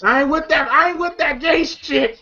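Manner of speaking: high-pitched imitation of a protest; high retold outcry; mock protest. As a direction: object in a high, hammed-up voice. Acting out Smokey protesting in house.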